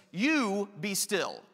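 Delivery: "You be still" slow, YOU leaned on; "You be still" drawn out, YOU weighted first; slow stress on You. Shown as a demonstration. Preacher to congregation, slow stress on "You". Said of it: "And then he says to the waves".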